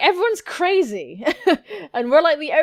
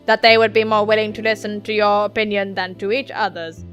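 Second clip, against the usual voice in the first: even, monotone robot voice; level-pitched android delivery, sliding up on odd words; stilted android; 2 semitones lower, 5 dB louder than usual. Acted. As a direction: speak mechanically, every word measured.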